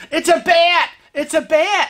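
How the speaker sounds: high, loud alarmed cry; retold panicked shout. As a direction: yell it like a bat is diving at you. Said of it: "and he's like".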